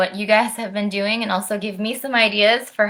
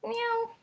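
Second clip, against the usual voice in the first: cat meow; 10 dB quieter than usual. Pretend. Cats on stage in book.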